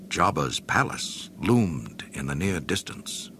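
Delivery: ordinary delivery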